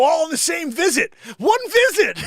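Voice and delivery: loud, high disbelief; shouting in disbelief. Real, not acted. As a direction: yell it, can't believe it. Excited outraged retelling.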